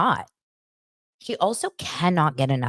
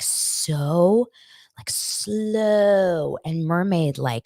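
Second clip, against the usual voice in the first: drawn-out slow; 2 semitones higher, much slower than usual. Real, not acted. Describing slow floating.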